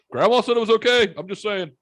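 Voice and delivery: high, loud mock self-defense; defensive protest. Grandson retelling his excuse to mom, hammed-up defensive protest.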